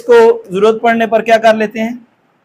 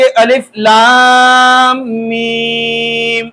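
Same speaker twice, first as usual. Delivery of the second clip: "अलिफ लाम मीम" with long-held "लाम" and "मीम"; drawn-out, stopping recitation; much slower than usual. Shown as a demonstration. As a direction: chant it very slowly, ending on a held hum. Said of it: "अगर हम रुक कर पढ़ें".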